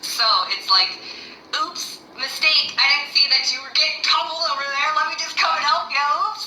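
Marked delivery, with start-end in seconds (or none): 1.5-6.4 high-pitched, playful oops apology; comic high-voiced admission of a slip; mock sheepish God. Voices God owning a mistake.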